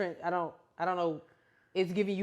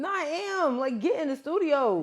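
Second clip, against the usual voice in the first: higher-pitched, exasperated insistence; upset; 6 semitones higher than usual. Real, not acted. Fan demanding an album from absent singer, upset. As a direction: plead in playful frustration. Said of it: "think you sound upset".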